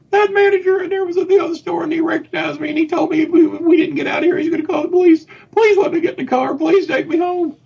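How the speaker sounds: hammed-up high wail of a mother begging to go home; whiny crying mom. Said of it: "she's crying".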